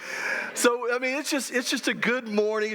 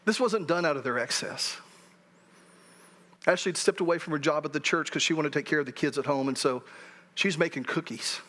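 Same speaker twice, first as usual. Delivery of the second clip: moved and emotional; 6 semitones lower than usual. Real, not acted. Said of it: "without getting emotional".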